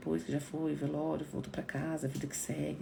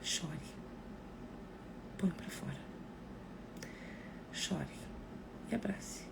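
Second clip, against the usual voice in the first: soft gentle urging; 2 semitones lower, 12 dB quieter, slower than usual. Shown as a demonstration. Comforting a grieving friend in a hushed voice. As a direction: murmur it tenderly. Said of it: "diga pra ela".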